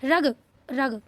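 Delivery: रग short, with no nasal before ग; read without nasal. Shown as a demonstration. Teacher reading the word without its dot, no nasal. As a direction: say रग quickly cut, no hum. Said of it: "बिना बिंदी के कैसे पढ़ेंगे".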